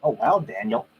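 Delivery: normal talking voice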